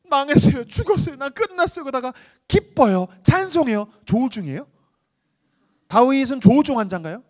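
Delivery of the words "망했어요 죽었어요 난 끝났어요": lament. Acted. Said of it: "보세요".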